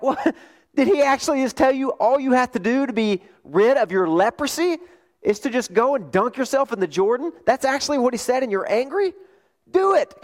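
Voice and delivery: high-pitched, astonished disbelief; incredulous servants. Acted. Servants urging their angry master to just do it, incredulous. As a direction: pitch way up, aghast that he refuses. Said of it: "They're like".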